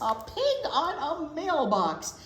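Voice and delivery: high, slow wondering question; playful mock surprise. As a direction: ham up the amazement.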